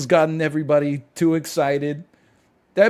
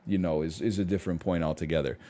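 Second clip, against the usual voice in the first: ordinary talk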